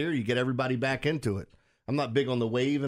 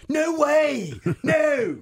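put-on much higher, loud, over-the-top Philly accent, laughing at the end; shouted Philly accent; 6 dB louder, much slower than usual. Host mimicking Philly coworker, loud mock Philly accent. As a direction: yell it in a hammed-up Philly accent. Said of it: "These accents are hilarious".

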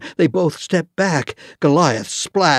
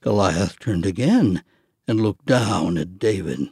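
usual speaking voice

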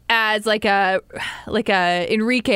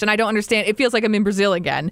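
usual speaking voice

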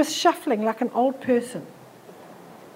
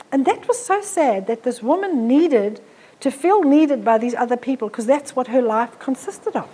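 ordinary delivery

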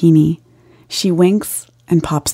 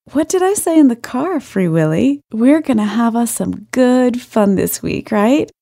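southern drawl; 5 semitones higher than usual. A narrator's reading. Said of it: "speaks with a southern drawl".

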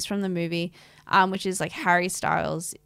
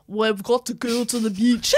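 imitating a Scottish actor, a little higher; Scottish accent; 3 semitones higher, 4 dB louder than usual.

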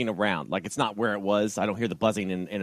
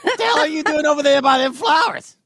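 yelling across; 7 dB louder than usual. Acted. Character shouts across.

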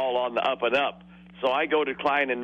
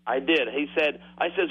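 usual speaking voice

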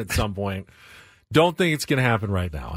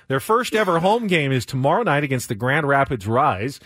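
usual speaking voice

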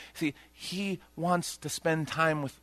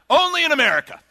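joking exclamation; 13 semitones higher, 9 dB louder, faster than usual. Real, not acted.

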